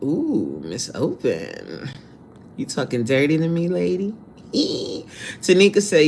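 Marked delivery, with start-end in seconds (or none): 0.0-1.9 low, slow teasing tone; flirty. Real, not acted. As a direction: drag the name out softly. Repeats name flirtatiously.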